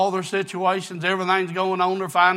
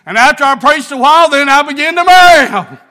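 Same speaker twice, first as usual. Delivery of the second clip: preaching at full force; 11 semitones higher, 12 dB louder than usual. Acted. Preacher retelling the dollar store wedding, building to a shout. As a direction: build the fire until you're crying it out.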